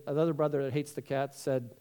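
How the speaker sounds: ordinary talk, nothing marked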